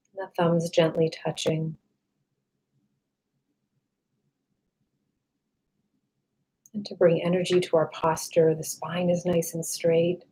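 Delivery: calm meditation voice with long, restful pauses; guided meditation. Real, not acted. Meditation guide leading listeners to relax the body, calm and unhurried.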